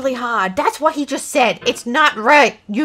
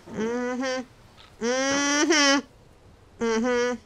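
ordinary talk, nothing marked

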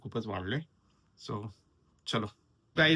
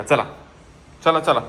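loud, high call to the cat; 3 semitones higher, 10 dB louder than usual. Real, not acted. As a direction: call the cat in from across the terrace. Calling the cats in. (मांजरीला मोठ्याने हाक)

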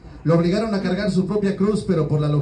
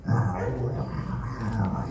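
stomach rumble; 9 semitones lower, 8 dB quieter than usual. Retold or imitated. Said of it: "mi estómago empezó a hacer".

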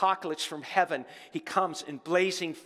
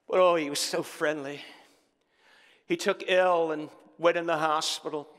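usual speaking voice

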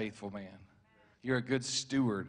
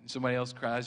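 ordinary talk

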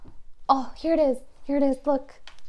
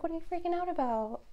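higher, quicker, softer pretend dog; quick, high, softer playful pet voice; dog's voice; 6 semitones higher, 4 dB quieter, faster than usual. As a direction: voice the puzzled dog lightly. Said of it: "She's like".

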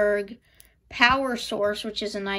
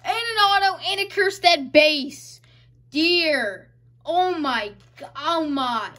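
high, awestruck exclaiming; overwhelmed excitement; 5 semitones higher, 5 dB louder than usual. Real, not acted. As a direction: wail it in happy disbelief. Still reeling from the card pull.

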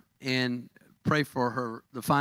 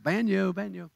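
put-on Southern accent; 5 semitones higher than usual. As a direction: say Baño like a Texan tourist, Southern accent. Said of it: "I said it with my southern accent".

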